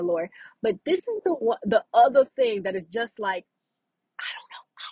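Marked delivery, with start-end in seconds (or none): 4.2-4.9 voiceless, giddy whisper; overwhelmed whisper. Real, not acted. Swooning over her favorite trait, overwhelmed whisper. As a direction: whisper it as if lost for words.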